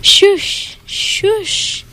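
high fading ski swish; ski swoosh. A narrator's reading. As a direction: let the skis hiss away. Ski sound effect.

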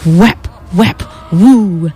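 playful storybook narration with spooky sound words; reading to children. Acted. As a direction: read it like a bedtime story for kids. Reads Halloween picture book aloud.

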